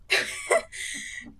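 very high, crying laugh of shock; nervous shaky laugh. Real, not acted. Gamer recovering from a scare, laughing nervously. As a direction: laugh off the fright.

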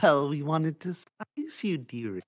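high, sweet motherly voice; doting mother. Acted. Mother surprising her daughter on her birthday, sweet doting voice. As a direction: coo like a proud mum.

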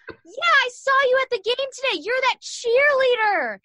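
high, loud hammed-up frat boy yell; mock drunk yelling. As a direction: shout it across the whole jail. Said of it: "And just screaming it".

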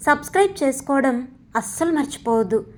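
ordinary delivery, nothing marked

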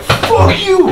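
very high-pitched mock cursing; imitated angry outburst. Listener echoes the storyteller's cursing bit, imitated outburst. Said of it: "you sounded like him right there. The, the fuck you part".

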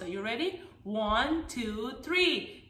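ordinary talk, nothing marked

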